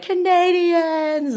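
high, slow, drawn-out thrilled cry; excited squeal. Retold or imitated. Two Canadians meeting abroad, high excited squeal. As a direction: stretch it out, thrilled to find a fellow Canadian. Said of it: "freaking out".

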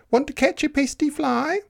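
very high rooster voice; crowing rooster. Acted. Rooster inviting the spider to catch a fly, high crowing. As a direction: crow the question up high. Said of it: "crowed the rooster".

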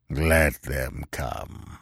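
a dismissive chieftain shrugging off the threat; slow, unbothered low grunt. A narrator's reading. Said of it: "grunted Yar Afzal".

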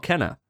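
Kenna said KEN-uh; old mispronunciation. Shown as a demonstration. Co-host questioning the correction, Kenna as KEN-uh. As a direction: say Kenna with a short e. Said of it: "we've been saying it wrong".

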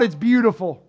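usual speaking voice

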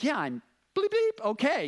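high, loud bleeps cutting into the words; bleeped-out swearing. Retold or imitated. Pastor replying to golf teammates with bleeped cussing. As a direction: censor the cuss words with squeaky bleeps. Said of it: "I'm like".

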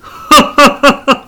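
a high, bright burst of disbelieving laughter; incredulous laugh. Acted. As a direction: laugh at how anyone could not know. 客がモンマルを知らないと笑う. (信じられないという高い笑い)

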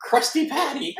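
very high, wobbly goofy voice; silly cartoon sponge. Sponge insisting to co-host he's sane, high goofy voice. Sponge character naming workplace.

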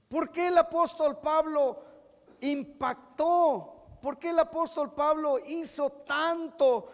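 loud, impassioned preacher building up; preaching at full force. Acted. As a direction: thunder it from the pulpit. (predicando a todo pulmón)

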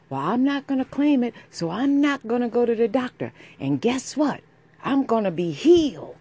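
high, loud mocking imitation. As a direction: sneer it in a high voice. Mocking the community attitude.

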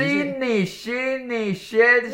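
Sydney high and very drawn out; exaggerated pronunciation. Shown as a demonstration. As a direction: stretch each Sydney out playfully. Jokingly demonstrating the English city name.

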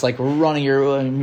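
normal talking voice

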